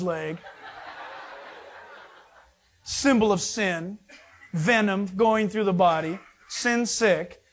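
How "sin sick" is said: normal talking voice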